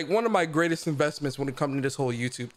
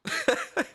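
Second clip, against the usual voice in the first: high giggle. Real, not acted. High laugh.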